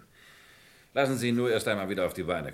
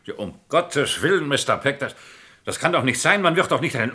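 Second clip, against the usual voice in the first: alarmed outcry; 5 semitones higher, 9 dB louder than usual. Acted. Alarmed at Bart's looming conviction.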